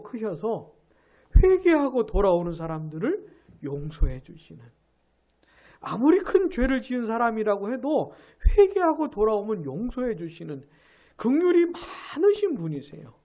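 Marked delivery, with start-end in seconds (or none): none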